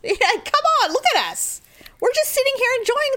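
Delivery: high, fast protesting innocence; playfully insisting we did nothing; defending ourselves to staring people, mock innocent.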